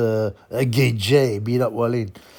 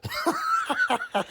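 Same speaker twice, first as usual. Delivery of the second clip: high cackling laugh; 6 dB quieter than usual. Real, not acted. Vlogger after his own boxer impression, high cackle. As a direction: burst out cackling at your own joke.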